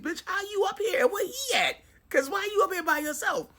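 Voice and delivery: high-pitched baffled questioning; confused challenge. Retold or imitated. Storyteller confronting an unexpected visitor upstairs, confused high challenge. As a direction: demand an explanation, voice way up. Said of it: "I'm still confused".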